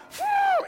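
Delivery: very high, loud snorting phew; deer blow snort. Retold or imitated. Preacher imitating a deer blowing at hunters. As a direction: blast air out like a snorting deer. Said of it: "you hear this loud".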